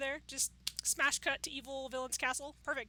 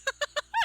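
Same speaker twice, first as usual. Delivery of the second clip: high, loud burst of laughter; 12 dB louder than usual. Real, not acted. Laughing at own joke.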